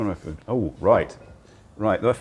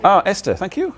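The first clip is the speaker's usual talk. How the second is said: excited; 12 semitones higher, 6 dB louder than usual. Real, not acted. Greeting a viewer on stream.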